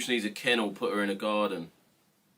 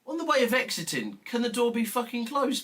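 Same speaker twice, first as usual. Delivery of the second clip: exasperated; 11 semitones higher than usual. Real, not acted. Scolding mum about door.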